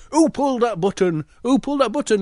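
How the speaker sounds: high, urgent demanding conductor; train conductor. Acted. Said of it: "conductor comes running down the train and goes".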